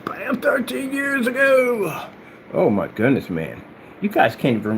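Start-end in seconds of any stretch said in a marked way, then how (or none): 0.1-2.1 very high, drawn-out crowing; triumphant mock cry. Topping his own boast to rivals, crowing. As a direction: sing out the boast, stretching it long.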